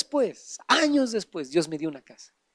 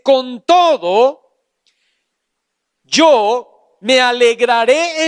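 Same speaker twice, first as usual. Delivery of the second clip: slow, raised declamatory reading; ringing pulpit recitation, higher and drawn out; preaching scripture; 5 semitones higher, 5 dB louder, slower than usual. Acted. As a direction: proclaim the verse, stretching each phrase. Declaims scripture at full force.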